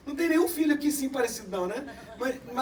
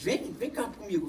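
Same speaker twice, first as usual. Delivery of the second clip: ordinary delivery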